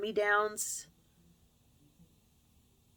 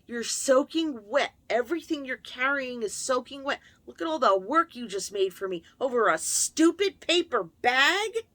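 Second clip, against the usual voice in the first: loud, exasperated scolding, higher; scolding mother; 6 semitones higher, 6 dB louder than usual. Retold or imitated. A mother scolding her soaked daughter, loud and exasperated. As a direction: lay into the child for getting everything wet. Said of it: "she just laced into me".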